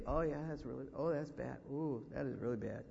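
low, gloomy mock sympathy; mock commiseration. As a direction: play the discouraging visitor shaking off any hope. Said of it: "you're not gonna sit and say".

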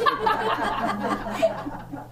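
a bright airy laugh, mostly breath; high breathy laugh. Real, not acted. Preacher laughing at his own story to the congregation, airy laugh. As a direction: let a quick laugh escape at the joke.

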